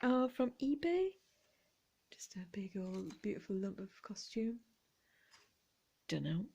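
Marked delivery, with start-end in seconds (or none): 2.1-4.6 whisper. Real, not acted.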